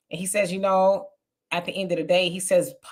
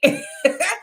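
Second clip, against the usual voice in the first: a quick real laugh, pitched up; short laugh; 13 semitones higher than usual. Real, not acted. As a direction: burst out laughing at the mix-up. Laughing at sister's mix-up.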